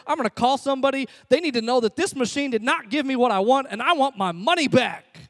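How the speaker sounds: high, loud indignant rant; angry. Retold or imitated. Customer wants to complain to the manufacturer, angry. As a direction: demand it in retold outrage. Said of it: "and I'm angry".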